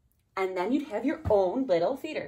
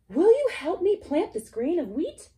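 high, louder pleading hen; hen asking; 10 semitones higher, 7 dB louder than usual. Acted. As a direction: ask sweetly as the hen. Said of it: "She asked the horse".

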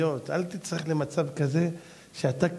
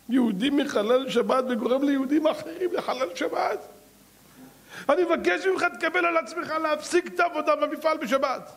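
higher, urgent plea to the factory owner; the rabbi pleads with the factory owner, higher and urgent; pleading rebuke; 10 semitones higher than usual. Acted. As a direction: beg him to stop, rising. Chafetz Chaim pleading with factory owner.

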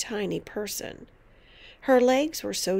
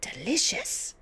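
higher, squeezed repeat; tight-lipped savouring; 4 semitones higher than usual. A narrator's reading. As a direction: say it through tightly pursed lips. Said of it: "She squeezed her lips together tight".

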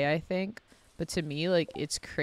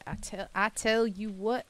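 higher, rising Southern twang; Southern accent; 5 semitones higher than usual. Shown as a demonstration. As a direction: bring out a Southern lilt, pitched up. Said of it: "a deep Southern accent".

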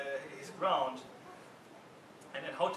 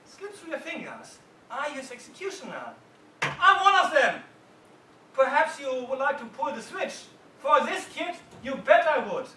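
heated retort; 7 semitones higher, 12 dB louder than usual. Retold or imitated. Heated jury room exchange.

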